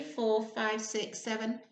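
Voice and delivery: plain reading voice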